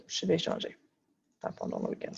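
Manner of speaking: pendant le week-end whispered under her breath; a quick unvoiced correction to herself; whispered self-correction. Real, not acted. Teacher whispering a correction to herself.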